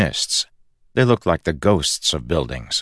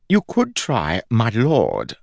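higher, slow, affronted suggestion; huffy; 6 semitones higher, slower than usual. A narrator's reading. God objecting to the nickname Fuzz, huffy. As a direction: draw it out with wounded dignity. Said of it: "Fuzz said with a huff".

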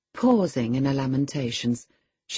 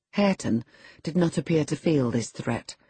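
plain reading voice

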